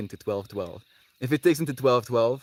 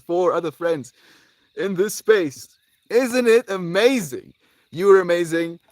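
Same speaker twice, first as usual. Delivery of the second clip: excited wonder; 8 semitones higher, 8 dB louder than usual. Real, not acted. Marvelling at viewers still there.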